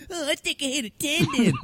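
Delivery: high, whiny mocking repeat; mocking echo. Host mocking the nurse's apology in a high whiny echo. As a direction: parrot him back in a silly high voice. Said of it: "I was like".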